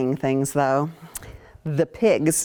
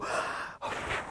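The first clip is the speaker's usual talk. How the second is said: long breathy blow; blowing sound; 13 dB quieter than usual. Acted. Teacher acts out the wolf blowing the house. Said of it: "what does he do?".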